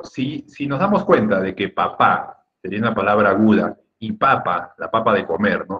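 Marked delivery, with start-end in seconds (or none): none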